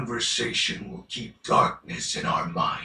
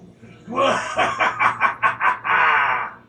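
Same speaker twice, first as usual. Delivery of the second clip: evil laugh; 5 semitones higher, 6 dB louder than usual. Acted. Villain's loud triumphant laugh.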